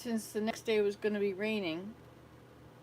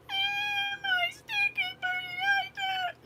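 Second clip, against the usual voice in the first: put-on high, louder tantrum wail of a little girl; wailing child; 5 dB louder, slower than usual. Grandmother voicing the wailing girl at the fire, high tantrum wail. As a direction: wail like a small child losing her toy.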